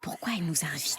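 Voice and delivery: hushed gossip; whispering while hiding. Acted. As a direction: whisper behind her back. Ados chuchotent dans son dos. (chuchoter en se cachant)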